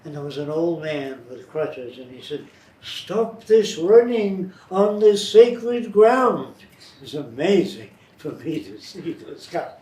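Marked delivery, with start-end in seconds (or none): 2.8-6.5 loud, slow, high scolding; slow loud old man scolding kids. Retold or imitated. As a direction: bellow it at the children, slow.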